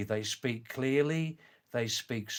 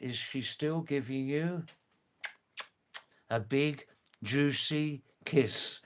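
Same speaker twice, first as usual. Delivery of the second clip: clear translation. Shown as a demonstration. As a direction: say each word clearly and unhurried, like a teacher. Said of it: "It means, in the translation".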